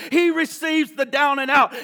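loud preached shout on the word he; shouted proclamation. Acted. Pastor shouting grace to the congregation, loud. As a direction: proclaim it to the back row.

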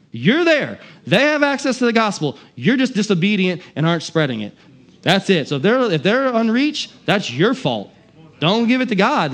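worked-up preacher challenging the congregation; all worked up. Real, not acted. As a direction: push each point home with rising fervor. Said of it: "I'm getting all worked up".

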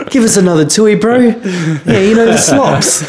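put-on Kiwi accent. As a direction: say it like a Kiwi lad. Mock Kiwi bloke quote.

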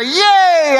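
high, loud drawn-out cheer; cheering crowd. Retold or imitated. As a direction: cheer like a lively audience. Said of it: "people would say".

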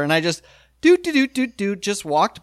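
high little doot doot doots, tiptoeing; sneaky doot humming. Retold or imitated. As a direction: hum doot doot while sneaking in.

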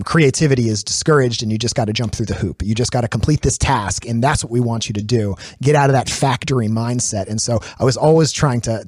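normal talking voice

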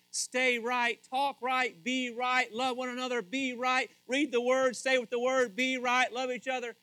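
high, loud rhythmic listing; preacher's exhortation. Acted. Preaches rapid exhortations at full force.

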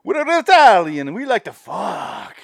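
hammed-up Italian, high then slow; mock Italian accent. Friend mocking Cage's Italian character, hammed-up Italian accent. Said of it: "He's like".

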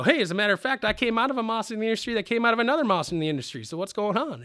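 high, loud, rattled-off retort; hammed-up rapid protest; cocky comeback. Acted comeback to the famous distiller.

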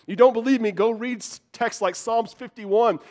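normal talking voice

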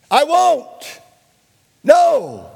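high, loud defiant yell; preacher's shout of no; shouted refusal. Shown as a demonstration. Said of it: "You have to shout".

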